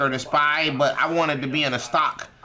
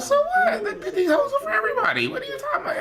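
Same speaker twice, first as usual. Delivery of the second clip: squeaky falsetto mockery, laughing; mocking squeal. Mocking jealous men over his girl in a squeaky falsetto. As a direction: squeal it high like a mocking cartoon.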